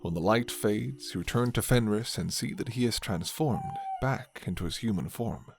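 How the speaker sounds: usual speaking voice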